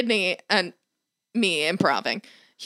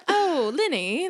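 slow, higher airy voice; affected, leisurely super-rich woman; airy rich lady; 6 semitones higher, much slower than usual. Retold or imitated. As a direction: drift through it airily, pitched up. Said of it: "Yeah, airy".

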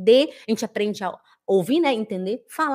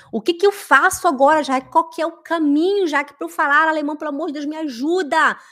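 pleading student; 5 semitones higher, 4 dB louder than usual. Acted. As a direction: mock-desperate plea, pitched high. (súplica aflita, encenada)

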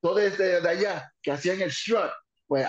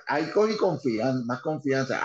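ordinary delivery

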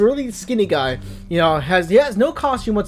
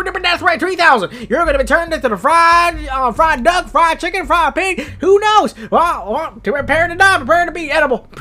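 high, loud cartoon villain rant; shouty pitched-up baddie; 7 semitones higher, 5 dB louder than usual. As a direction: threaten with manic, hammed-up relish. Villain rants threats at heroes.

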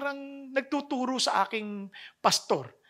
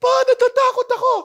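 put-on frightened child; 9 dB louder, faster than usual. Child afraid to jump.

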